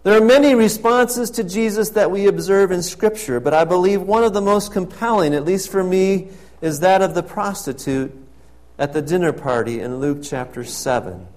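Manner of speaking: loud, ringing pulpit proclamation; fervent preacher's call; preaching at full force. Acted. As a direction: proclaim it to the whole church. Loud preaching of prostitute story.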